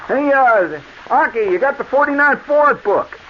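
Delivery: calling out. Retold or imitated. Salesman calling out to Aki in the back for the book. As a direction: shout across the shop to Aki.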